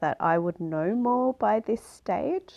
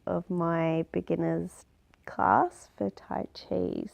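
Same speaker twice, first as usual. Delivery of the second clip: usual speaking voice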